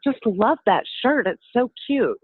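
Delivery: gushing shopper. Retold or imitated. Shopper complimenting the Plexus shirt, gushing high and loud. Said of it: "and she said".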